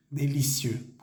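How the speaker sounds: ordinary delivery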